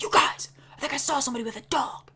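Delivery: whispered, fast retelling of a scared friend; hushed warning. Retold or imitated. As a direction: whisper it urgently like someone saw something.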